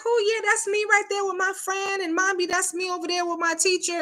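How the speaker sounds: put-on high excited child's voice. Parent voicing an excited child showing the yearbook, high and bubbly. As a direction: chirp it like a thrilled kid. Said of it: "your child is all excited".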